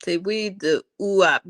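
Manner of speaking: normal talking voice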